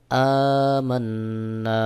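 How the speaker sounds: আমান্না slowly, nasal ন্ন, long final আ; slow, stretch the last vowel; one alif stretch. Shown as a demonstration. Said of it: "এক আলিফ টান দিতে হবে". (টান দিয়ে আমান্না)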